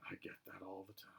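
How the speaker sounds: a tired snake confiding; snake's voice. Acted. The snake tells Harry it gets that all the time.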